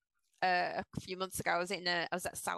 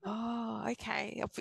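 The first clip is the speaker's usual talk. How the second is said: ordinary talk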